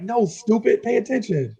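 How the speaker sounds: high, exasperated put-down; impatient scolding. Retold or imitated. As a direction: talk down to the clueless kid. Friend scolding him.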